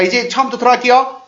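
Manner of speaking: normal talking voice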